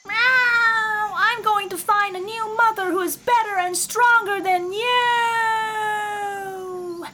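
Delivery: high, loud kitten voice; a spoilt kitten calling back high and loud; kitten's voice. Acted. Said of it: "came Kitten's voice".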